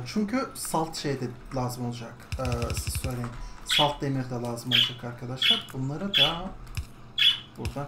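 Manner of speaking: ordinary talk, nothing marked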